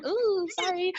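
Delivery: high, sheepish ooh sorry; cringing little apology. Retold or imitated. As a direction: play it off with an embarrassed squeak. Apologising for lipstick mess.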